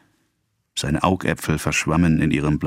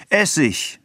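calling out; 11 semitones higher than usual. A narrator's reading. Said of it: "rief ihr Charles zu".

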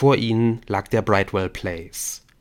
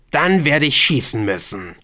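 louder, higher ultimatum; forceful threat at gunpoint; threatening; 5 semitones higher, 6 dB louder than usual. Acted. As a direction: threaten a hesitating captive. Helen threatens to shoot.

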